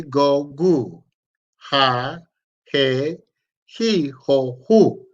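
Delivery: "ba, be, bi, bo, bu" through "ha, he, ho, hu" spaced out for the class to repeat; slow call-and-repeat of "ba, be, bi, bo, bu" up to "hu"; choral syllable drill. Shown as a demonstration. Teacher leading the class through the syllables together. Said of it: "we're gonna pronounce this together".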